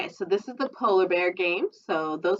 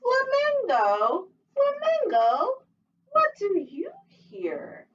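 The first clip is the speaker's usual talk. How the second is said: much higher, slow, fluting call; high flamingo call; much slower than usual. Acted.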